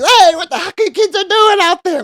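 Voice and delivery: very high, loud scolding shriek; mock angry lady. Lady yelling at kids throwing rocks, high scolding shriek.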